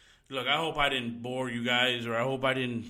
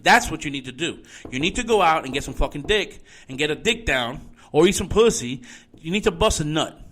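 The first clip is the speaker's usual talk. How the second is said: louder, heated rant hurled at the listeners; angry yelling; 6 dB louder than usual. Real, not acted. Ranting host yelling at teachers pushing gender topics, furious and loud. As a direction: yell it at them, fed up and furious. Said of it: "not yell at everybody so much".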